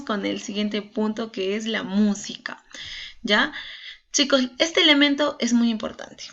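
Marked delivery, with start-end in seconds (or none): none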